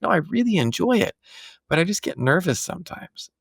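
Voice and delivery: lightly voicing his nervous daughter; daughter quoted. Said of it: "She's like".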